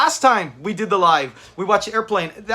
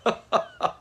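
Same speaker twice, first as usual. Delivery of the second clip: high laugh; 5 dB quieter than usual. Real, not acted. Laughing at Fred.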